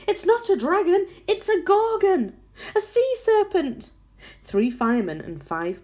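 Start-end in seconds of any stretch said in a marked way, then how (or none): 0.1-3.9 high, loud cries of alarm; panicked shouting. A narrator's reading. Policemen and firemen naming the monster above, panicked shouts. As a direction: shout each monster's name as if in a frightened crowd. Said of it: "all started shouting at once".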